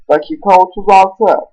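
ordinary talk

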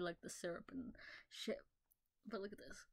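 usual speaking voice

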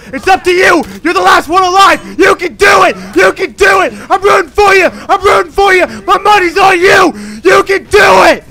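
high, loud rooting yells; root for him like a fan in the stands; cheering on. Real, not acted. Gamer cheering his last surviving teammate, loud rooting.

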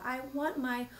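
high demand into rapid stutters; acted cross, freezing queen; angry, shivering. A narrator's reading. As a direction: demand it through a freezing stammer. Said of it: "She shivered and shook on her throne".

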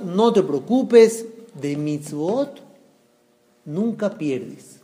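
retold rabbi soothing, unhurried and soft; calm reassurance. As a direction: reassure gently, drawing the words out. Rabbi calms worried man.

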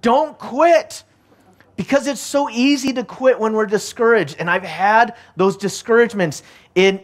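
rallying sermon climax; preaching. Acted. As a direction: build the urge not to give up. Exhortation continues.

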